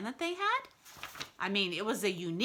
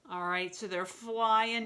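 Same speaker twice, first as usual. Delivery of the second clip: usual speaking voice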